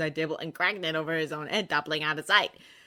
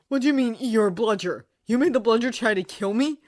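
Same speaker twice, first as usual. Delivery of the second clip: louder, higher outraged question; angry disbelief; 7 semitones higher, 9 dB louder than usual. A narrator's reading. Harry confronting Dobby about the bludger, loud angry. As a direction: demand an answer, furious. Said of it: "anger rising".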